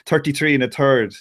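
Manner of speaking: "thirty-three and a third" with every TH said as plain T; Irish TH as T. Shown as a demonstration. Irishman showing what the English tease, "thirty-three and a third" with T for TH. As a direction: say "thirty-three and a third" as tirty-tree and a tird. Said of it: "I say it like I normally say it".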